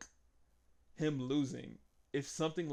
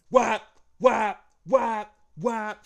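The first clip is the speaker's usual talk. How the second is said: shouted, higher whop whop sound effects; loud imitated chair-shot noises; 6 semitones higher, 13 dB louder, much slower than usual. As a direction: belt out each whop like a chair hitting a back.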